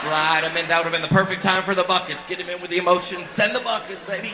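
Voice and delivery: loud, high mock fundraising holler; mock hype. Comic hustling the crowd for bucket money, mock hype shout. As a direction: call out like a hammed-up collection drive. Hyped-up mock call for donations.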